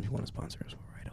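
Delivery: whispered aside with a laugh; whispering. Real, not acted.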